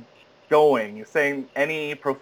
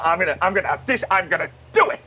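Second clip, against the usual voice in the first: psyched up; 5 semitones higher, faster than usual. Retold or imitated. Psyching himself up to audition.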